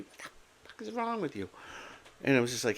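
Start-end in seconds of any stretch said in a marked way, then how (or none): 0.0-1.5 imitating his old outburst at his son, half-hearted; retold yelling. Man recalling how he used to yell at his son, mock angry yell. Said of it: "yelling at him".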